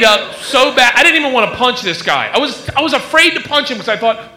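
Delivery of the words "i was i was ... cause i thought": ordinary talk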